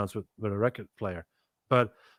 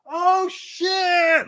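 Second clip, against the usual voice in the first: much higher, excited yelp; mock hyped homie cheering; hyped exclamation. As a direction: hype up like a crowd that's impressed. Crips hyped by bass line.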